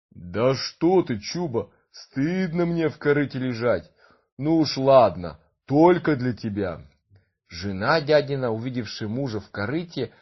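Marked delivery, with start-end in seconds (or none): 0.1-6.8 gentle giant voice. Acted. Giant grudgingly agrees.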